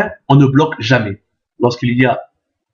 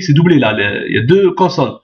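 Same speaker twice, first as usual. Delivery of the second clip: normal talking voice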